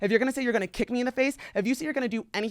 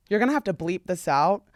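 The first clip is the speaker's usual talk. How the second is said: normal talking voice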